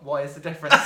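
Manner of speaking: puzzled and amused; baffled. Retold or imitated. Reacting to the film's line, baffled and amused. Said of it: "And I thought".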